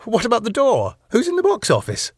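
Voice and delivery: very high, indignant questioning; alarmed. A narrator's reading. Husband asks wife about the unmanned door, alarmed. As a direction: demand answers, pitch shooting up.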